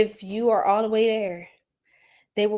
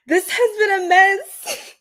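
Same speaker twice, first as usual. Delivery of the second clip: mock dismay; 9 semitones higher, 6 dB louder, slower than usual. Real, not acted.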